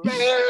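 high, helpless laughter. Real, not acted. Retelling the turkey scene to friends: high, helpless laughter.

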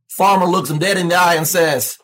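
ordinary talk, nothing marked

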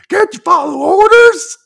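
very loud, high, drawn-out yell; hammed-up sergeant outrage at full volume; drill sergeant scolding. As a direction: bellow it right into a recruit's face. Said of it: "the drill sergeant's like".

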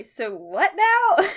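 high, loud, drawn-out baffled question; all confused. Retold or imitated. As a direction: stretch it upward in mock bewilderment. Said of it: "all confused".

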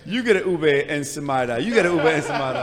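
loud, booming talk-show giveaway; Oprah. Pretend. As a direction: announce it like a TV host handing out prizes. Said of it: "she's like Oprah".

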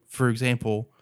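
ordinary delivery

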